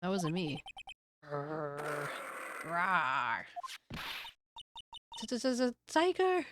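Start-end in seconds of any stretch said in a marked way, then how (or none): 1.2-3.4 low growl then a roar, hammed up; lightly voiced tiger noises; mock tiger growl. 5.2-6.5 stammered, higher cry of fright; mock-terrified stammer; scared stutter. Character panics at tiger.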